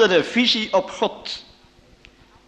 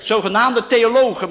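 in quotation marks; 6 semitones higher, 5 dB louder than usual. Shown as a demonstration. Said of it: "Dus aanhalingstekens".

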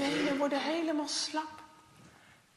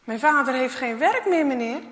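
put-on boy's answer; 13 dB louder than usual. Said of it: "dan zegt Jasper".

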